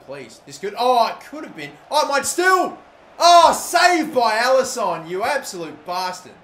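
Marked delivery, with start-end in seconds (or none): 1.9-6.3 high, loud frustrated yell at the keeper; excited yelling. Real, not acted. Gamer yelling at the keeper's save, loud and high. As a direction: yell as the shot gets saved. Close save during the final.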